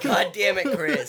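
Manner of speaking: loud, high, through real laughter; amused, helpless groan of defeat; laughing exasperation. Real, not acted. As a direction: curse him while cracking up. Cracking up at Chris's line.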